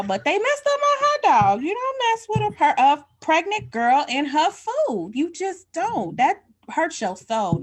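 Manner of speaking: high, loud comic outrage; a pregnant woman defending her hot dog, loudly indignant; mock indignation. Real, not acted. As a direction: rant it up high like a wronged diva.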